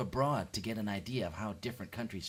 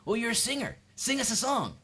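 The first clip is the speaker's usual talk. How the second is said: high mock-enthusiastic demand; imitating a presumptuous fan; pushy request; 11 semitones higher, 4 dB louder than usual. People expecting a singer to perform, high mock-eager demand. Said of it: "people just expect you to perform".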